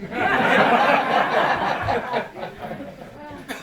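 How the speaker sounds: loud hearty laugh at his own joke; big amused laugh; real laugh. Real, not acted. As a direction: burst out laughing. Laughs at Monte Carlo joke.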